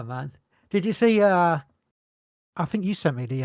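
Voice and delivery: ordinary talk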